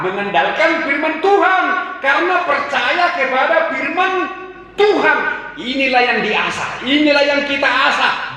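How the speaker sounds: high, loud build on firman Tuhan; full-force preaching. Acted. Preacher urging the church to rely on God's word, high and loud. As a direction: drive the word home to the church.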